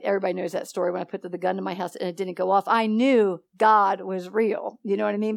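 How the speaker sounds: ordinary talk, nothing marked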